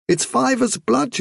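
high-pitched mother's voice; high, matter-of-fact mother rabbit. Acted. Vilthuril explains her youngster's gift.

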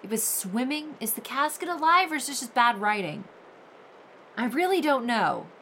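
ordinary delivery

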